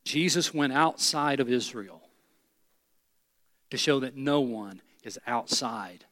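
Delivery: low, soft, hushed invitation voice; altar call invitation. Acted. Preacher leading the congregation in bowed prayer, hushed. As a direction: draw them in gently with heads bowed.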